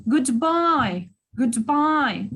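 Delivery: Goodbye with a long, strong BYE; stress on second syllable. Shown as a demonstration. Teacher showing the stressed syllable, Goodbye stressed on the second. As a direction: lean hard on BYE in Goodbye. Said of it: "on the second syllable".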